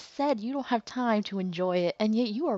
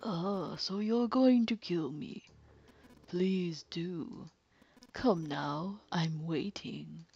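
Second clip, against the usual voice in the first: calm sinister villain; slower than usual. Acted. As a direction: purr the confession like a cold killer. Villain taunts Snake.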